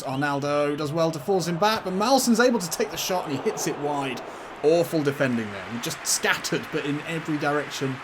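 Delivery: excited commentary. Real, not acted. Gamer commentating on a shot at his goal, excited. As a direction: call the chance with rising urgency. Live commentary on a dangerous attack.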